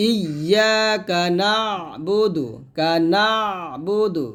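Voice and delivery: slow chant, "না" closed in mid-throat; stretched "না" with a throat squeeze; pressed ayn. Shown as a demonstration. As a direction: recite "ইয়া কা না", pressing the ayn before "বুদু". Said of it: "চাপ দিয়ে নিয়ে আসবেন".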